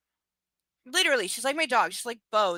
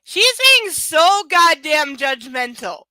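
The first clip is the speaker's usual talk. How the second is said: exasperated; 4 semitones higher, 10 dB louder, slower than usual. Real, not acted. Ranting at book character.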